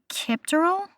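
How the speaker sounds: tentative attempt at saying "Kipterl"; unsure pronunciation. Real, not acted. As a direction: try "Kipterl" with a questioning upward lilt. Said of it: "I definitely pronounced that wrong".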